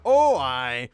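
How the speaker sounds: put-on loud, broad Northern English Yorkshire farmer accent; mock Yorkshire farmer. Friend taking the mick out of his accent, strong Yorkshire farmer. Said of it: "Northern English Yorkshire farmer".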